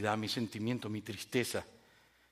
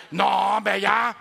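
loud, higher-pitched mock brush-off; annoyed refusal; 5 semitones higher, 5 dB louder, much slower than usual. Youngster asked to bring milk, acted exasperated refusal. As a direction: protest like a put-upon youngster.

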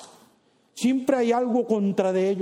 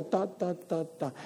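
mumbled gossip; 9 dB quieter, slower than usual. Acted. Mocking gossip.

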